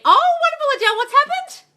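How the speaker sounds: high, loud gushing oh wonderful; gushing delight. Real, not acted. Coach gushing at a viewer's news, loud and high. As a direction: sound thrilled for her.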